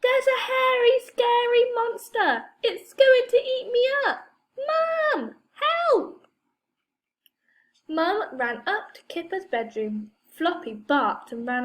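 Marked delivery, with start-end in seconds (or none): 0.0-6.3 high, loud mock terror; child crying wolf, loudly pretending fright; fake panic. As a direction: ham up being scared to fool Mum. Kipper fakes fright to trick Mum.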